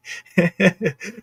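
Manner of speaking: high, breathy chuckle at catching yourself; amused chuckle. Real, not acted. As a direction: chuckle after nearly letting something slip. Breaks off, amused at near slip.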